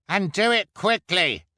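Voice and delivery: high, loud impatient shout; impatient cry. A narrator's reading. Spectator hurrying the victims into the pit, high impatient cry. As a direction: cry it out high over the crowd. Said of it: "cried the man with pimples".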